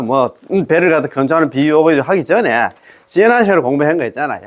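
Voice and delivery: normal talking voice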